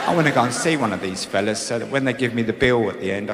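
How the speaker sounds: usual speaking voice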